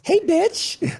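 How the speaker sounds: high cheeky "Hey, bitch"; mock friendly greeting. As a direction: greet a bestie with a teasing squeal. Acting a friendly bar greeting.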